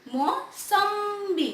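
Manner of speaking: Mosambi drawn out slowly in a sing-song. Shown as a demonstration. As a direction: stretch mosambi like a little chant for the kids. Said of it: "क्या हो जाएगा?".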